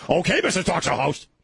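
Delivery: high-pitched imitation of an exasperated wife; mock irritated wife. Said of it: "My wife will give me that crap".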